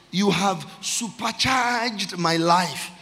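slow proclamation with SUPERCHARGED stretched out; psalm declamation. Real, not acted. Preacher praising God within the psalm, projected slow declamation. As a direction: project "supercharged" and hold it long.

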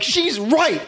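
high-pitched mock frenzy; acted mob outrage. Villagers losing it over wife's accusation, mock frenzy. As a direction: agree in a high, hammed-up cry.

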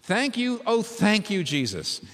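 high-pitched gushing thanks, dropping at the end; jubilant mock shouting. A narrator's reading. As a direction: gush with self-satisfied gratitude.

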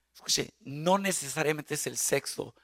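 normal talking voice

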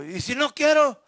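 a listener's cheeky challenge; defiant objection. Pretend. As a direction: play the stubborn believer pushing back.

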